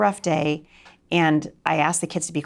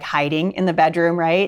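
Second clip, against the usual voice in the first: ordinary talk, nothing marked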